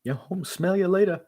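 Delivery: high, punchy rap line; high yell. Acted. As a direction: squeak it out high.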